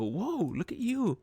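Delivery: high-pitched, hyped-up compliment; imitated admiring reaction. Acting out people seeing the new look, admiring squeal. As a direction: swoop way up like an impressed friend.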